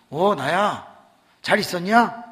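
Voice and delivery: loud, cocky friend on the phone; brash friend. Retold or imitated. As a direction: greet him like a swaggering buddy. 친구 전화 목소리. (전화한 친구가 큰소리로 반갑게)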